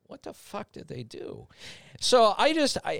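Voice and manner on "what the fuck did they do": hushed whisper to self; whispered thought. Retold or imitated. Narrator's silent reaction in the meeting, hushed whisper. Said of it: "first natural inclination is".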